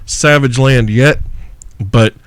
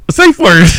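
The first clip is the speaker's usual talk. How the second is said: punchline shout; 7 semitones higher, 4 dB louder than usual. Acted. Punchline about ball gag.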